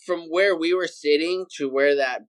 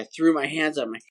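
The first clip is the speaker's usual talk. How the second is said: ordinary talk, nothing marked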